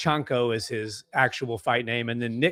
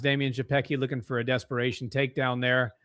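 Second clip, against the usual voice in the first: ordinary talk, nothing marked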